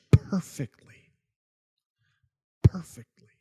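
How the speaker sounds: hushed whisper on "perfectly". Real, not acted. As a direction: breathe the word out in a whisper, with space around it. Whispers key word twice for effect.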